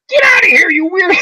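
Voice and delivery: exasperated shout. Retold or imitated. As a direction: throw the weirdo out. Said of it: "And he goes".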